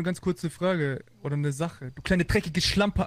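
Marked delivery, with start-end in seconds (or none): 1.9-3.1 slow, loud furious insult; angry insult. Real, not acted. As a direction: spit the insult out slowly. Angry rant after hang-up.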